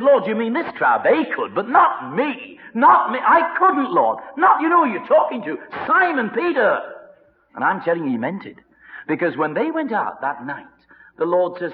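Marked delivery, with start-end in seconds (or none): 0.0-6.8 high, loud, insistent protest; boastful protest. Acted. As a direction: Peter swearing he'd never deny him. Peter protests he'd never deny.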